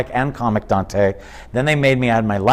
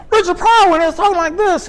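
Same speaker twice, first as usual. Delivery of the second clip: much higher, louder hyped Pryor voice; mock high-energy Pryor; 5 dB louder, faster than usual. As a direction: blast it out like a young Pryor. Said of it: "he wasn't like".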